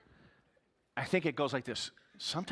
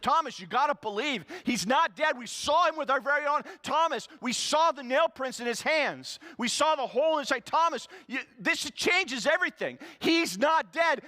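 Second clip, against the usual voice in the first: much higher and louder, near shouting; pleading disciples; 12 semitones higher, 5 dB louder than usual. Acted. Disciples pleading with Thomas, near shouting. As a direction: beg him to believe, voice climbing.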